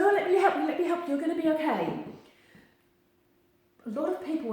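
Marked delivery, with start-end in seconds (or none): 0.0-2.0 high, loud, rushed mock helper voice; hammed-up overeager rescuer, fussing; mock fussy helper. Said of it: "Going across, going".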